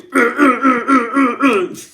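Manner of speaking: shrill strangled cry at a spider; spider scream. Retold or imitated. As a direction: let out a panicked squeal. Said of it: "Je fais".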